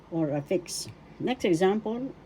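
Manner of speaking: ordinary delivery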